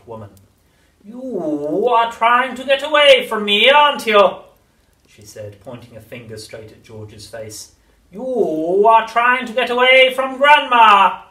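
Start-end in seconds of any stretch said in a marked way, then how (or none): 1.0-4.4 high, slow, accusing crone; accusing witch. A narrator's reading. Grandma accusing. 8.1-11.2 high, slow, accusing crone; accusing witch. A narrator's reading. Grandma accusing.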